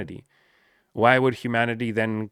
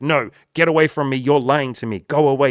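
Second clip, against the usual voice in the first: higher, louder hurt shouting; panicked angry yell; 4 semitones higher, 8 dB louder than usual. Pretend. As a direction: push everyone away shouting. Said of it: "we're like".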